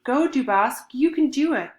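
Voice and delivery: loud, high cheering shout; shouted encouragement. Acted. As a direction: yell it up the rope to cheer him on. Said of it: "One of them shouted".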